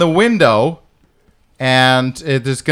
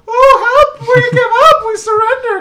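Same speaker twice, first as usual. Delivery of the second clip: fake surrender. Players luring elves in with a high mock plea. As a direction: ham up a fake cry for mercy to lure them in. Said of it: "And then we go".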